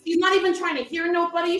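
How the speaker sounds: ordinary talk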